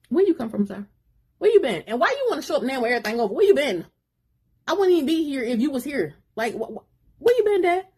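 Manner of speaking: high, loud demands tumbling out; a son demanding where his father has been; upset son. Acted. Son confronting his dad, high and loud.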